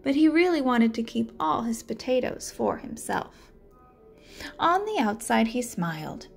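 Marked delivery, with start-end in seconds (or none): none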